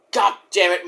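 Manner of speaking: ordinary talk, nothing marked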